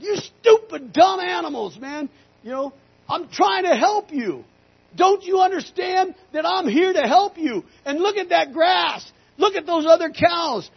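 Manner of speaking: high, loud tirade at the animals; angry screaming. Retold or imitated. Frustrated rancher to stubborn cows, angry screaming. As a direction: yell in exasperation, full voice. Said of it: "I'm screaming at them, and I said".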